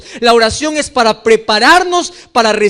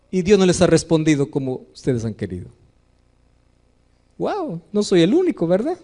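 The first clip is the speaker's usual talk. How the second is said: usual speaking voice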